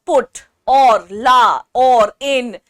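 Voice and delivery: each syllable loud and separate; "put", "la", "in" each punched out loudly on its own; example syllables. Shown as a demonstration. Teacher giving students sample syllables, loud and separated. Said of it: "ये सब आपके syllables हैं".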